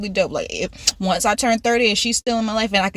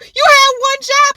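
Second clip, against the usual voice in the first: exasperated scolding; 14 semitones higher, 6 dB louder, slower than usual. Real, not acted. Mocking the cookout worker.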